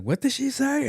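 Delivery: very high, startled question; imitated alarmed mom. Mom overhearing the rowdy friends, mock alarm. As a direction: squawk it as a shocked mother. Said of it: "the mom's like".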